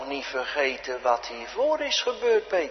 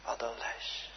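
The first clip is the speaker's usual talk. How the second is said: breathy whisper, barely voiced; awed hush; 4 semitones lower, 15 dB quieter than usual. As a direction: whisper it to yourself. Quiet reflective aside in sermon.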